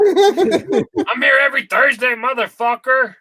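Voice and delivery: high, drawn-out imitation rant; hammed-up high-pitched defiance; mock crazy lady. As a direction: stretch it out like a brazen ranter. Acting the ranting woman's reply.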